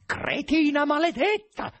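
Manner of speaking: high, loud, slow strangled curse; rasping rage. A narrator's reading. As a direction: choke out the insult, drawn out and furious.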